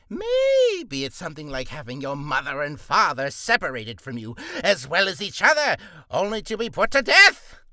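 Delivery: a petulant villain king working himself up to a fight; King Flabbergast. Acted. King challenging Max Dougal to a duel, building to a shout. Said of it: "let us do King Flabbergast".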